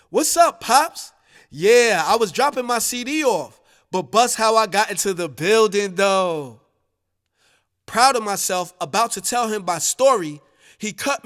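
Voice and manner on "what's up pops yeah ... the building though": excited. Acted. Son telling his father about dropping the CD, excited at first. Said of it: "I continued excitedly".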